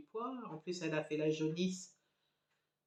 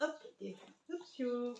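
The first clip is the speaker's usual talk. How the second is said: cheering the dog; 7 semitones higher, 6 dB quieter than usual. Real, not acted. Lifting the dog up.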